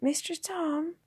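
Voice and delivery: put-on high, small croaking child's voice; weak croak. A narrator's reading. Waking boy calling to his guardian, croaking. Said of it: "he croaked".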